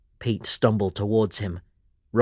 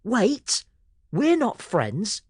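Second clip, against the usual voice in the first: put-on very high boy, slowing on "not friends"; quick correction; 12 semitones higher, much slower than usual. A narrator's reading. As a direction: set the record straight. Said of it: "Pete started".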